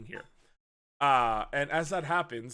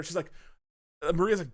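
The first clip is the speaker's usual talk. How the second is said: ordinary talk, nothing marked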